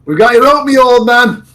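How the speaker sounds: loud, high holler through the door; shouted announcement to someone behind a door; calling out. Pretend. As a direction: holler it up high like a delivery call.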